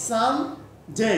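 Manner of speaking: "সান জে" split by a clear pause; syllable-by-syllable pronunciation. Shown as a demonstration. Teacher puts the sounds together for student, "সান... জে" in two parts. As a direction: say "সান", pause, then "জে" with an "ey" glide. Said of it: "তাহলে উচ্চারণ হবে".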